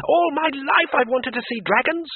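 very high, thrilled cry; excited scream. Acted. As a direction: shout with childlike delight. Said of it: "screamed the tourist".

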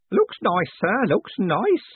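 put-on high, slow, wavering old postman voice; old Grote. A narrator's reading. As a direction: play a frail old man, pleased.

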